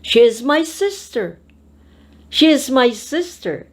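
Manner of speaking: "sister" lifted high, then falling at the end; stress on SISTER. Shown as a demonstration. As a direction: land the emphasis on sister. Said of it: "decide on which word".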